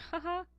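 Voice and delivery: imitating a small high laugh; high-pitched laugh. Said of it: "little high-pitched laugh". (mimicking coworker's little high laugh)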